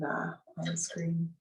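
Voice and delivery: ordinary delivery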